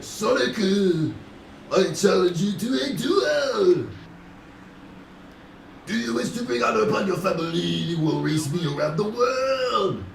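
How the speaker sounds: high, dramatic shouted challenge; cartoon rival challenge. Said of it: "It was like".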